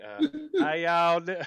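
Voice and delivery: loud, put-on Southern y'all; Southern accent. Naples man hams a Southern accent, loud. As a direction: belt out a hammy Southern greeting. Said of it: "I said the wrong accent".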